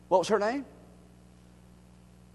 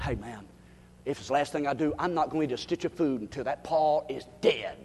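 low, soft plotting voice; conspiring low; 6 semitones lower, 7 dB quieter, faster than usual. Acted. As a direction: hush it like a secret vow. Forty men plotting, voiced.

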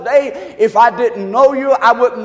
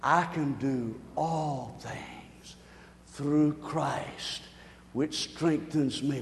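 much softer, slow, breathy reciting; hushed recitation; 10 semitones lower, 17 dB quieter, much slower than usual. Shown as a demonstration. Pastor leading the congregation, a hushed recitation. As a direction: say the verse low and slow with the people. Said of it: "Say it again with me".

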